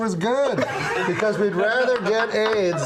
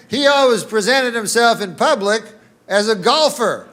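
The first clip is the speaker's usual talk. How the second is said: ordinary talk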